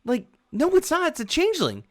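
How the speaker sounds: high, insistent denial; guard disputing with other guard; arguing guard. Acted. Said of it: "they go".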